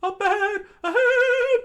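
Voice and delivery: very high, loud falsetto; squeaky warning scream. Acted. Sanji warning Luffy of the ledge, in a squeaky scream. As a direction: shout the warning in a tiny voice.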